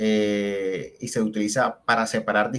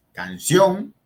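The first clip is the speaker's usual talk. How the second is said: Canción with a loud, raised -ción; can-CIÓN, leaning hard on the end; stress on last syllable; 7 semitones higher, 5 dB louder than usual. Shown as a demonstration. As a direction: punch the final syllable of Canción. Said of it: "Lleva la fuerza en la última sílaba".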